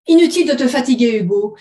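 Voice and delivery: loud, brisk brush-off; quick retort. Acted. Woman to her ex-husband, quick retort. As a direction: shut him down at once. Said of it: "répliqua-t-elle du tac au tac".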